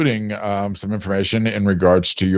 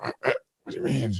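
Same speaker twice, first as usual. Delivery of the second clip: groggy confusion; 5 semitones higher, 10 dB quieter, faster than usual. Retold or imitated. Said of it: "I'm like".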